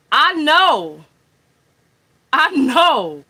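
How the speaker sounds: loud, stubborn denial; insisting loudly, refusing to accept; loud insistence. Real, not acted. Host loudly denies hang-up.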